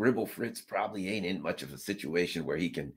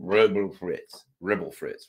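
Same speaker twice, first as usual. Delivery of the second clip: slow mumbled gibberish; a hint of mumbling; 4 dB louder, slower than usual. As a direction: mumble it like a confused old man.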